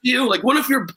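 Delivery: cocky taunt. Retold or imitated. Young comic mocking the crowd.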